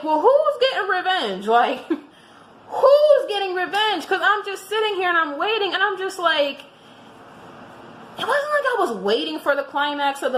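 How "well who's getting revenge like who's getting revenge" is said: laughing, pitched up high. Real, not acted. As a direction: laugh through the question. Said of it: "I'm like".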